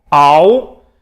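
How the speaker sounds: "Au" loud, as one gliding ow; "Au" said like ow in one breath; diphthong demo. Shown as a demonstration. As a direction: sing out "Au" clearly for the class. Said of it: "Olha lá em cima".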